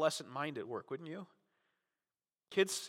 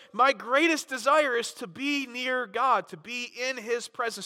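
fervent; 8 semitones higher, 8 dB louder than usual. Retold or imitated. Voicing Jesus' longing, preaching loud.

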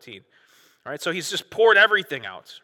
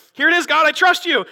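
much higher and louder declaration; exclaimed trust; 13 semitones higher, 10 dB louder than usual. Retold or imitated. Pastor voicing David's turning point, much higher and louder. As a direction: proclaim trust in God.

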